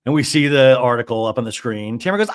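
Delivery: usual speaking voice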